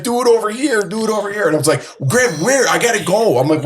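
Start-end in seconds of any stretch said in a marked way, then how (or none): 0.0-1.5 high-pitched, insistent grandma orders; lightly voiced old woman, pitched well up; imitated grandmother. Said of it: "And she's like". 2.1-3.4 high-pitched, impatient pleading; mildly frantic, pitch lifted; retold harried plea. Acted pleading with grandmother to hurry.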